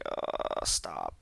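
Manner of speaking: low, quiet groan of disappointment; disappointed groan. Retold or imitated. Retelling a reaction to Travolta's accent, disappointed. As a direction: groan it out softly, pitch dropped. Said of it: "I was really disappointed".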